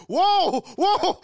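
very high, loud whoas; mock-shocked cry at an outburst; shocked reaction. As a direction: yelp it out, acting stunned. Acts out shock at mom's outburst.

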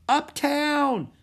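high, loud yell. A narrator's reading. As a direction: shout directions over roaring waves. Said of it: "yelled Annie".

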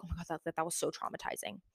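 fake-shocked, breathy and soft; mock dramatic. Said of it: "they know I'm joking".

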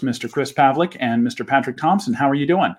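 ordinary talk, nothing marked

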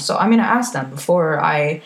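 ordinary delivery

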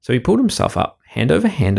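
ordinary delivery

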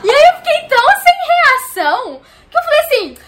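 words broken by laughter, high and loud; laughing hard. Real, not acted. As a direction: laugh your way through it. Laughing while retelling the scene.

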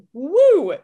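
a high, loud, swooning woo; excited woo. Real, not acted. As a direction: let out a giddy whoop at a hot character. Swooning over a handsome cartoon clone.

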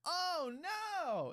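high, loud cry of Oh no, hammed up; mock alarm. Thor hears the planet-eating plan, hammed-up high alarm. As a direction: yelp it like a panicking hero.

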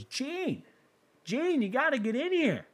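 very high, thrilled calling; amazed husband. Acted. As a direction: call your wife in, astonished. Husband calls wife from toilet.

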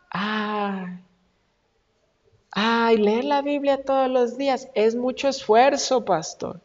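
put-on weary whine with a drawn-out "ay"; mock complaining. Church member complaining to the pastor about effort, mock whining. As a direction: groan it like a reluctant churchgoer.

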